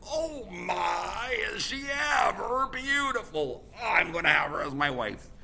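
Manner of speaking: a booming wind struck by beauty; west wind voice. Acted. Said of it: "and he said".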